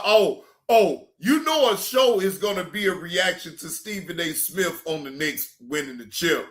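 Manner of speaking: usual speaking voice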